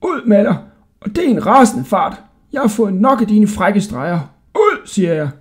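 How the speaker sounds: high, indignant commands; angry ordering. A narrator's reading. Jailer fed up with tricks, high angry ordering. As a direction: chase the boy out with your voice.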